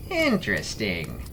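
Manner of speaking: slow, low, thoughtful "Interesting"; musing. Acted. Salas ponders the banshee bounty, slow and low. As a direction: let it hang as you ponder. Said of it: "mused Salas".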